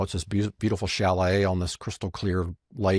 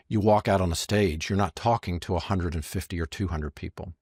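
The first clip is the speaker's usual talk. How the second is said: ordinary talk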